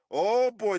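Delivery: ordinary talk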